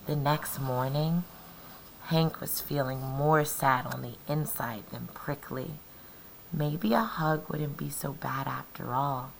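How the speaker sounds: plain reading voice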